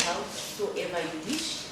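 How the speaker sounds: ordinary talk, nothing marked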